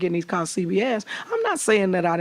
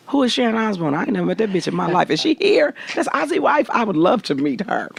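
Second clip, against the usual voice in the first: feigned ignorance; 6 semitones higher than usual. Retold or imitated. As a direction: play dumb with big innocent surprise.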